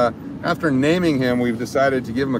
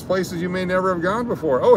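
usual speaking voice

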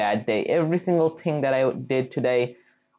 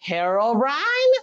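drawn out, higher, mock-ominous; dramatic suspense; 7 semitones higher, slower than usual. Gamer hints at a creepy Minecraft legend, mock-ominous drawl. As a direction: tease the spooky legend's name. Names the spooky game legend.

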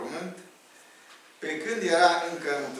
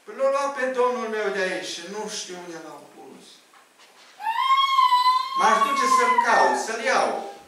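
higher, pleading voice of Mary; Mary's lament; 11 semitones higher, 5 dB louder than usual. Retold or imitated. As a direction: plead like a grieving woman looking for him. Retells weeping Mary's answer.

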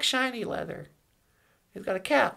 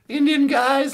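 normal talking voice

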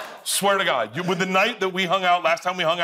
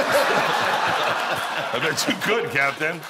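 laughing; much slower than usual. Retold or imitated. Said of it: "I'm like".

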